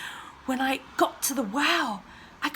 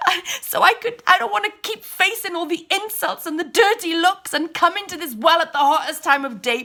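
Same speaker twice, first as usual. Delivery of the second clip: loud, high crying plea; tearful plea; 6 semitones higher, 8 dB louder than usual. Acted. Kneeling woman begs the stranger for living water, crying. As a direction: beg him with tears breaking through. Falls on her knees begging.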